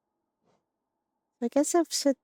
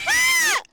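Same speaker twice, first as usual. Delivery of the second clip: startled shriek; 4 dB louder than usual. Real, not acted. Game hit.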